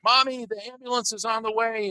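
imitated child calling out. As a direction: call to mommy as a sister would. Sister calling out to mom.

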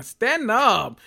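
high, loud urging; rallying shout of support; emphatic cheer. Pretend. Cheering on a character.

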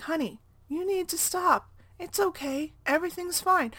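put-on high, gentle voice of a kind lady calming a child; soothing stranger. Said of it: "really soothingly talked to my son".

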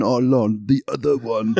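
lightly put-on French accent; attempted French accent. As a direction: imitate someone fumbling through a bit of French. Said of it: "trying to speak a bit of French".

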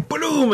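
high-pitched "boom" sound effect; imitated gunshot boom. Telling friends how he fired staple gun, high boom. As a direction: fire off a high, playful boom.

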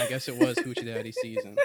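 high, through laughter; laughing. Real, not acted. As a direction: giggle the words out. Laughing at her own joke.

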